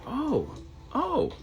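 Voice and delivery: high rising oh; surprised realization. Retold or imitated. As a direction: squeak oh in dawning surprise. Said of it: "I was like".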